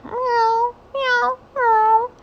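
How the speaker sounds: very high, far mewing; cat meows. Acted. Mother cat calling her kitten, high meows.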